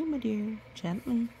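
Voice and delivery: ordinary delivery, nothing marked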